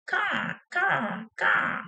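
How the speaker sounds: high-pitched cawing like a crow; mimicked crow calls; crow caw imitation. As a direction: caw three times like a blackbird. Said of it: "'cause he's going".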